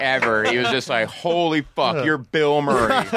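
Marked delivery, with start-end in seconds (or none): 1.1-3.0 higher, stretched-out awe; starstruck disbelief. Retold or imitated. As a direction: retell a fan who can't believe who it is. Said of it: "the most starstruck I had ever seen".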